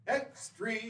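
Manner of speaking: high newsboy hawking papers; rapid old-time reporter. Acted. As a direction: cry out the headlines on the corner. Newsboy call again.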